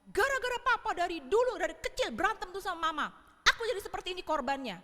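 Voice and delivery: loud, high outburst at parents; furious grown child accusing father; angry blaming. Acted. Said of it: "menyalahkan orang tuanya".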